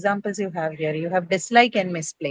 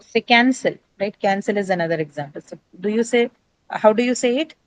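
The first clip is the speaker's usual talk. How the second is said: ordinary talk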